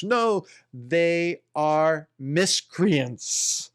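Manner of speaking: slow, with a throat clear caught in the words; throaty take. Shown as a demonstration. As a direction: say it slowly, throat still rough.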